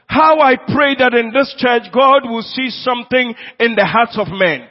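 ringing sermon peak; full-force preaching. Acted. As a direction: drive it to the back of the church.

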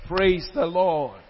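ordinary delivery

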